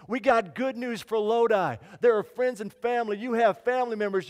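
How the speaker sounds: loud, fervent preaching. Acted. Pastor rousing the congregation, fervent and loud. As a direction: call out to the whole church.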